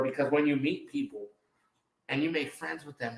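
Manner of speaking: normal talking voice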